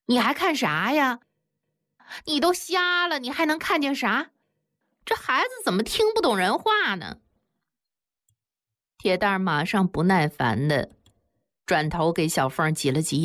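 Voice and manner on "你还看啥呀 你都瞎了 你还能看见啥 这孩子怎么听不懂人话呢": exasperated, much higher pitch; aunt losing patience with the blind child; shrill-pitched exasperation, much higher; impatient outburst. A narrator's reading. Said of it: "马上就着急地说".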